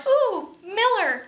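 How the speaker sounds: slow, high musing coo; slow, high pondering of a name; mulling it over. Pretend. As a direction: savour the name like a shopper. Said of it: "I'm like".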